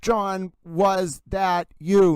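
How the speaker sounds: "was that you" stretched out slowly, word by word; slow drawn-out intonation. Shown as a demonstration. Host sounding out a disputed voicemail, slow and drawn out. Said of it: "get through the intonation".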